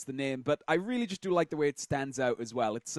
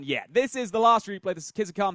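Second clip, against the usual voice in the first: ordinary talk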